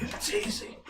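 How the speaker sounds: mock Biden whisper. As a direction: whisper it like a frail old president.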